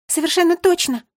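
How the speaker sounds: high, loud chirp; eager high-pitched assurance. A narrator's reading. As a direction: chirp it like a little bird. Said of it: "прощебетала Мира".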